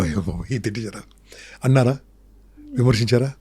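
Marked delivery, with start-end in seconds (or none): 0.0-1.0 an aghast hushed oh my god; shocked whisper. Real, not acted. Guest says many cursed Shiva, host whispers in shock. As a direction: breathe it out in shock, unvoiced.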